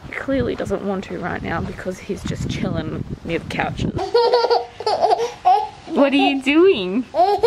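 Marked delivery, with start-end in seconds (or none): 4.0-5.8 loud high burst of laughter. Real, not acted. Laughs at lazy toddler. 5.9-7.4 slow, laughing question. Real, not acted. Laughing at toddler.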